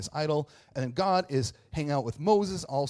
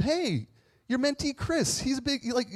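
gushing fan; 12 semitones higher than usual. Pretend. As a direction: gush like an eager fan meeting a hero. Mock fan greeting his mentor.

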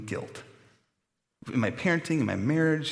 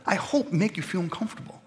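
ordinary talk

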